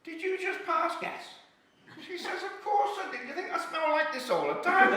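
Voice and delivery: put-on high old lady; old woman teasing her friend on a bench, high voice. Old ladies bickering on bench.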